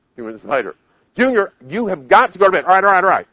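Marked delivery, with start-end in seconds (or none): 1.2-2.7 high, loud insistence; acted mom at wits' end; exasperated mother. Mother raising voice at ignoring child. 2.7-3.3 high, loud put-upon reply; acted exasperated kid; irritated child. Child fending off nagging mother.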